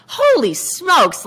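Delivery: high, very drawn-out retold shock; stunned exclamation. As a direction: stretch it out in dramatized disbelief. Quotes own shock at lawsuits.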